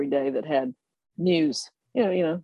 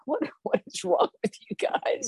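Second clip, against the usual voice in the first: much higher, laughing disbelief; can't believe what these guys are doing; incredulous colonel; 10 semitones higher, 4 dB quieter than usual. Retold or imitated. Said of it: "he was like".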